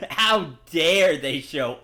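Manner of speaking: slow, loud mock indignation; mock outrage. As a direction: ham up the outrage. Mocking outrage at lazy kobolds.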